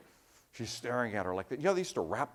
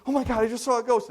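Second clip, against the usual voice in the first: higher, louder and fast, shaken by a ghost; frightened ghost witness; 10 semitones higher, 9 dB louder, faster than usual. Retold or imitated. Frightened woman after seeing ghost.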